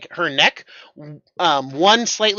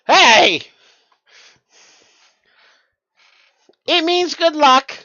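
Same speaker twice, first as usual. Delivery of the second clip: high loud cheerful call; cheeky call out; 9 semitones higher, 6 dB louder than usual. Acted. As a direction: shout back cheekily while leaving. NPC calls out, high.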